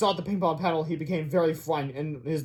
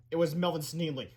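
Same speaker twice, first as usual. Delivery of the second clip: usual speaking voice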